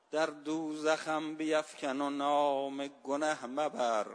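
slow, low recital of the verse; poem recital. Shown as a demonstration. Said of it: "خیلی زیبا گفته صائب".

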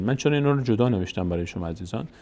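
ordinary talk

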